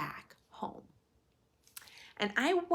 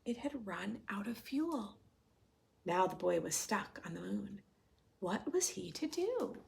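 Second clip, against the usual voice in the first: storytelling voice; 4 semitones higher than usual. A narrator's reading. Reading picture book to class.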